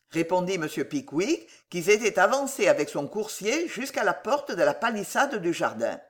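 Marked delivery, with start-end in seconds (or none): none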